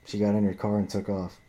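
usual speaking voice

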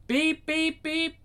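high, even electronic pips; imitated machine beeps. Reader imitating an answering machine, high beeps. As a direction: pip it out like an answerphone.

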